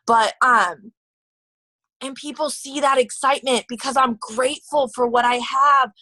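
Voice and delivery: ordinary talk, nothing marked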